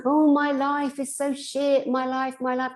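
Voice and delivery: high, slow whiny wail; mock self-pitying whine; self-pitying moan. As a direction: wail it like a hard-done-by drunk. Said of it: "you should have heard me".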